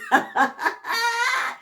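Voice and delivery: high laugh bursting out; burst of laughter. Real, not acted. Laughing while retelling mom calling from the bathroom. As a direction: laugh at the memory. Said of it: "as soon as I heard her voice".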